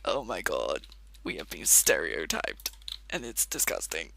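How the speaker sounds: hammed-up offended Australian in a low voice; mock outrage. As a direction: play the scandalised Aussie, pitched right down. Mocking offended Australians' reaction.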